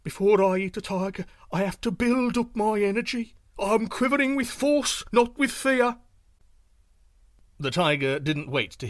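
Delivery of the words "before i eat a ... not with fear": high, loud deer voice covering fear; bluffing little deer. Acted. Yu Yu bluffs the tiger about his trembling, high and loud. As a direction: insist hard that it's strength, not fright.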